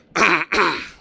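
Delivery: loud throat clear. Real, not acted. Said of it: "Excuse me".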